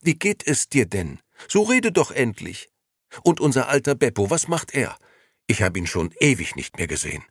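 put-on excited old friend pouring out questions. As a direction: gush it out, barely pausing for breath. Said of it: "redete aufgeregt weiter".

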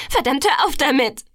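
urgent exclamation; exasperated cry. A narrator's reading. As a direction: cry out, demanding it stop. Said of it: "rief ich".

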